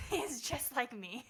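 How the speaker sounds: real laugh at the resemblance. Real, not acted. Mother comparing her hard-headed son to herself, laughing.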